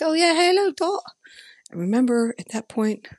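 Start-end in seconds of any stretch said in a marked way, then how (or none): 0.0-1.0 quoting Robert. Retold or imitated. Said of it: "He's like".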